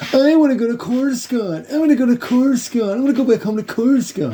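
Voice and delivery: put-on high, far whiny kid voice, wanna stretched; whiny Padawan. Mocking the whiny Padawan begging to go home to Coruscant, high whine. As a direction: whine it like a spoiled apprentice begging. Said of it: "your typical whiny Padawan".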